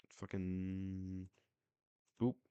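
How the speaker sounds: ordinary delivery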